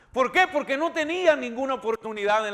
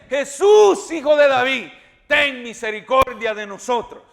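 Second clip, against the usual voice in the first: shouting louder; 6 dB louder than usual. Acted. Blind men shouting to Jesus over the crowd, loud plea. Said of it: "gritaron más fuerte".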